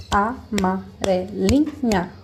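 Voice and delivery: "Amarelinha" said very slowly, each syllable apart; teacher sounding out "Amarelinha" slowly. Shown as a demonstration. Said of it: "Cinco sílabas".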